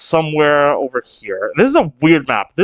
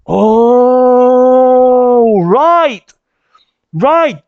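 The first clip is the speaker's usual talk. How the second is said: loud, high "oh, right" as it clicks; sudden realization; 7 semitones higher, 6 dB louder, much slower than usual. Real, not acted. Reader suddenly remembering the story, surprised exclamation. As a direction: blurt it out as the memory hits.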